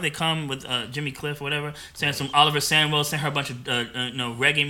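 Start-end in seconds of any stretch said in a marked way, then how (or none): none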